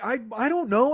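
high-pitched, sheepish shrug of a reply; helplessly defensive. Retold or imitated. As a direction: imitate a cornered friend. Friend acted at a loss.

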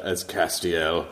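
slow, mock-deep angel voice; forced deep voice. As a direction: impersonate a solemn character, drawn out. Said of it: "imitating my, my forced, uh, deep voice".

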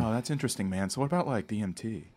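hushed podcast-host impression, lightly done; Joe Rogan. Guest doing an impression on the host's request, lightly hushed podcaster. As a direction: attempt a soft, musing podcaster's cadence. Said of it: "Let me hear your Joe Rogan".

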